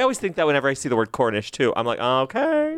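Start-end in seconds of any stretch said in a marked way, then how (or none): 2.0-2.8 high, put-on knowing okay; playfully intrigued. Host reacting to the word Cornish, playfully intrigued. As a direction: pitch it way up, mock-impressed.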